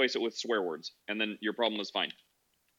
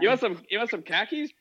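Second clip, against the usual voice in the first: high-pitched mock Boston accent question; raised, teasing Boston accent; Boston accent meme; 8 semitones higher, 4 dB louder than usual. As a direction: put on a hammed-up Boston accent. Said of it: "Yeah, it's Boston".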